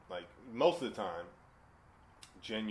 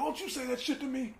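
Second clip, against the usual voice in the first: high, strained mock threat; hammed-up confrontation, pitched way up; confrontational mimicry. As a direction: imitate an angry guy getting in someone's face. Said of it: "the guy got in Brian's face".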